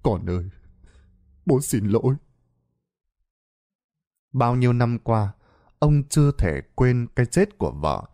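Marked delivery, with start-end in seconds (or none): 0.0-2.2 softer, lower, voice choked with grief; choked-up apology. Acted. Father apologizing to dead wife and sleeping son, choked up. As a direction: apologize through held-back tears. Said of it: "Ông nghẹn ngào".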